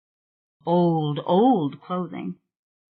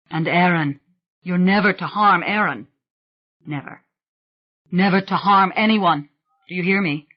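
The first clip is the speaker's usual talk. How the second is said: firm command; 4 dB louder than usual. A narrator's reading. Rowan forbids harm firmly.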